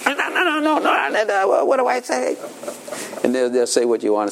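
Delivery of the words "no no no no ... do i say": put-on very high childish no's; imitating a toddler; defiant toddler. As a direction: squeal like a small child. Child refusing, then asking.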